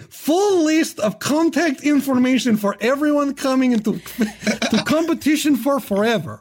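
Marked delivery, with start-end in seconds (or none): none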